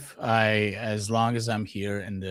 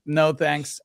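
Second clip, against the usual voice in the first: louder, higher mock-emphatic rebuff; retold firm refusal; 8 semitones higher, 8 dB louder than usual. Telling of rebuffing a Jehovah's Witness, emphatic refusal. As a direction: recount turning someone down, loud and emphatic.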